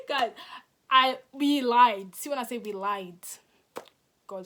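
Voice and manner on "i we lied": slow and much louder, every word hit; emphatic confession. Real, not acted. Confessing to viewers that they lied, slow and loud. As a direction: drag out the admission and punch it.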